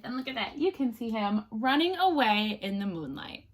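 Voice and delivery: ordinary delivery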